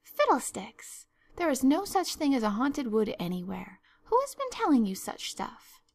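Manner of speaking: emphatic, impatient dismissal, rising high; scornful scolding. Acted. Marilla asks who told Anne such stuff, scornful. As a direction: dismiss it as rubbish, then demand who said so.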